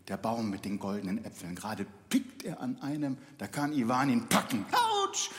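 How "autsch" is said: squeaky high bird yelp. Acted. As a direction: yelp ouch like a small bird. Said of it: "sagt der Feuervogel".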